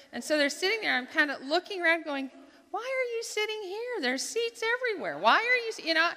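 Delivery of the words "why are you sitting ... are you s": high, puzzled grumble at the couple; why here of all places; indignant inner voice. Retold or imitated. To herself about the couple, a high indignant grumble.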